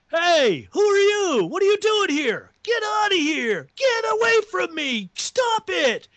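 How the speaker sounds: high, loud panicked yelling; imitated screaming. Diner customer messed with by unseen soldiers, imitated screaming. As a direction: act out a man screaming at the top of his lungs. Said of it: "He's screaming at the top of his lungs".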